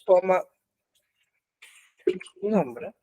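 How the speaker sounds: normal talking voice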